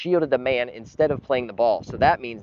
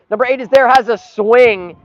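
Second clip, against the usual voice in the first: much higher, louder play-by-play; excited call; 12 semitones higher, 13 dB louder than usual. Real, not acted. Streamer calling a near chance for his team, excited. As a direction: rise with the chance, then deflate.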